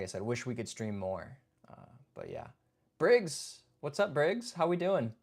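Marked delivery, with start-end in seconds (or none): none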